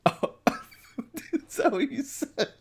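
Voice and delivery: high-pitched laugh through words; giggling while talking. Real, not acted. Laughing at friend's joke.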